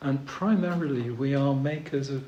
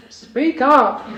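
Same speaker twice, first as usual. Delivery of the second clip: loud, high-pitched heckle from the crowd; shouting woman; 11 semitones higher, 14 dB louder than usual. Acted. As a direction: shout it up to a far-off preacher. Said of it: "a woman shouts to Jesus".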